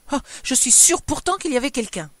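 high, loud, vexed insistence; indignant wife refusing to back down; furious declaration. A narrator's reading. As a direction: insist stubbornly, put out. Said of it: "furieuse, regagna son lit en déclarant".

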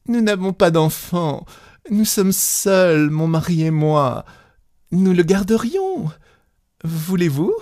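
gather breath and beg gently; pleading, catching breath. A narrator's reading. Young wife pleads with the peasants, breathless and high. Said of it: "Elle reprit haleine et continua".

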